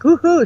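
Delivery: a quick sound-effect whoop for something flying off; mock whoosh cry. Describing the spirit rushing out, a playful woohoo.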